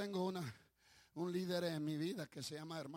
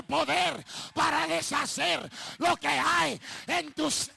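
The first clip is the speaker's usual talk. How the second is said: full-force preaching; 10 semitones higher, 14 dB louder than usual. Real, not acted. Preacher urging the crowd to applaud God, full-force preaching. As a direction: preach at a high, loud shout.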